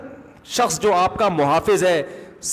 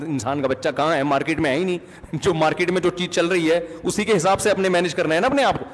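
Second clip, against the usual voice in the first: ordinary talk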